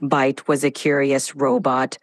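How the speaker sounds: low monotone robot storyteller; dramatic robot, level and mechanical; robotic voice. Pretend. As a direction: recite it like a robot, all on one low note. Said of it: "can you do this in a robotic voice now".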